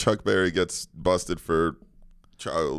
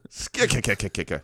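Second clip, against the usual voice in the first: Popeye. Pretend. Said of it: "That was my Popeye".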